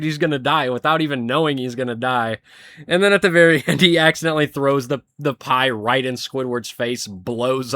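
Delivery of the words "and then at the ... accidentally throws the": ordinary talk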